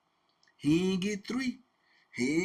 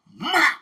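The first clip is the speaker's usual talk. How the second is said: loud, high "mop"; imitated blow; 11 semitones higher, 12 dB louder than usual. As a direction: burst out a sudden whack noise.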